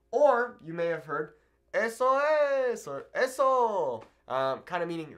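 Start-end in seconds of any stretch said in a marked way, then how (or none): 1.7-2.9 drawn-out, high cheer of approval; approving exclamation. Acted. 3.1-4.0 high, drawn-out cry of approval; approving exclamation. Acted.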